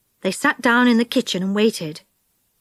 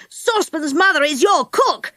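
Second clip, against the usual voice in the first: shout; 5 semitones higher than usual. Acted. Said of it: "he shouted".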